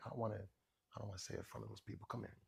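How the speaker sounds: very soft, quick aside; barely audible confidence; secretive mother. Retold or imitated. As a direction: imitate a mother avoiding being overheard. Mother avoids being overheard.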